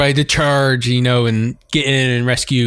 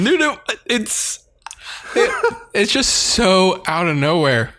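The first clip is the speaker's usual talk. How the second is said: high, slow, wheezing laughter in the words; laughing; 6 semitones higher, slower than usual. Real, not acted. Telling the co-host how random it was, laughing. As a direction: still can't stop laughing.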